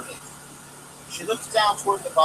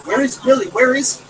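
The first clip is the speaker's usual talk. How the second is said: higher and louder frantic calling; breathless cry; 8 semitones higher, 8 dB louder than usual. Acted. Mother crying out to the returning children, frantic and loud. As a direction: cry out for the missing boy. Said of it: "cried the mother, nearly breathless".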